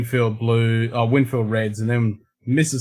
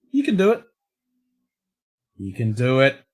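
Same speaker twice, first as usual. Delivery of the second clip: mock encouragement. Voicing OBS encouraging the connection.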